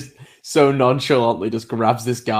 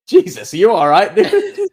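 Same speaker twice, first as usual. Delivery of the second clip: high, louder mock alarm; alarmed driver; 9 semitones higher, 5 dB louder than usual. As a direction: imitate a shocked driver checking on someone. Driver alarmed after hitting man.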